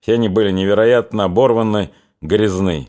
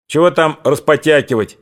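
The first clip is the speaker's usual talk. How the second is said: higher-pitched, impatient cut-in; impatient interruption; 6 semitones higher than usual. A narrator's reading. Cossack cutting off his comrade's argument, impatient. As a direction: break in to end the discussion. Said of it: "перебил его один из казаков".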